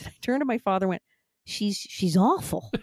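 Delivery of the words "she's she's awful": slow, drawn-out mock dismay; appalled verdict. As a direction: retell it as a child aghast at the character. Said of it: "go in and read it like that".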